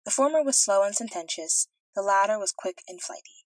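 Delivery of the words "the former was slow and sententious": usual speaking voice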